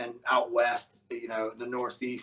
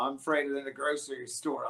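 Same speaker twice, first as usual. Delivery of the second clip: ordinary delivery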